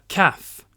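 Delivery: "calf" said as kaf with no L sound; silent L. Shown as a demonstration. Teacher pointing out silent L, models "calf". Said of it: "le L est silencieux".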